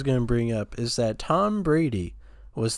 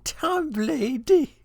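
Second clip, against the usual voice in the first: laughing imitation; 13 semitones higher, slower than usual.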